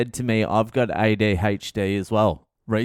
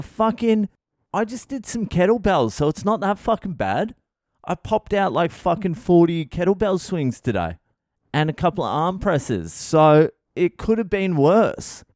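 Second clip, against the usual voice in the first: defensive excuse; 7 semitones higher than usual. Retold or imitated. Husband defending himself.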